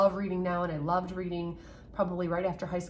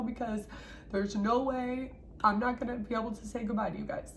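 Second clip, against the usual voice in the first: holding back tears; 5 semitones higher than usual. Real, not acted. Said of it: "I'm crying because I miss you".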